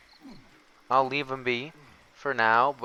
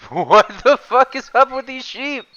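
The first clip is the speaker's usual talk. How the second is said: high, loud disbelieving yell; shocked shout; 16 dB louder than usual. Real, not acted.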